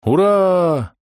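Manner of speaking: high, drawn-out toast cheer; crowd cheer. A narrator's reading. Tablemates answer a toast, cheering high. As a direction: cheer as a whole table would. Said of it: "закричали за столом".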